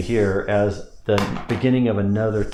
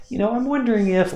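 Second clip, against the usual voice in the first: much higher, light and lifted; high pitch; 13 semitones higher than usual. Shown as a demonstration. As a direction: start the phrase up high. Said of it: "I start out high, high pitch".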